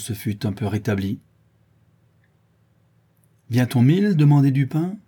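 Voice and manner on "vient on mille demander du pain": raised, louder challenge; indignant exclamation. A narrator's reading. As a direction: cry out the question at the crowd. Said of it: "s'écria-t-il". (s'écrier avec force)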